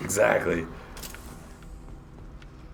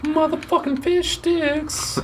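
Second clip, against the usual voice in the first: silly high voice; slower than usual. Acted. Silly outburst quoting a joke.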